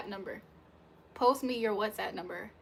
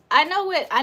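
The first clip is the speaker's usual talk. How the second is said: loud, high insistence on know; emphatic; 6 semitones higher, 12 dB louder than usual. Real, not acted. Emphatic reply to chat.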